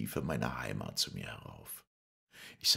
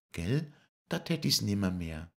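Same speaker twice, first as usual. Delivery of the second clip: high, louder voice; Lisei's voice; 8 semitones higher, 5 dB louder than usual. Acted. Lisei tells Paul she loves puppetry, high louder voice. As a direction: answer high and firmly.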